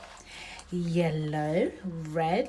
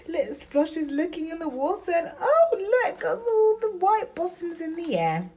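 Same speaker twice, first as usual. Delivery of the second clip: higher, giggling delight; laughing excitedly; 11 semitones higher, 8 dB louder than usual. Real, not acted. Mom laughing with her daughter at the duck bottoms. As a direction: burst out laughing at the silly picture. Mother excited over funny picture.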